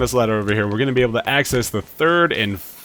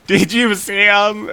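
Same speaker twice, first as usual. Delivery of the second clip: teasing sing-song; 10 semitones higher, 5 dB louder, slower than usual. Real, not acted. Teasing viewer about gears.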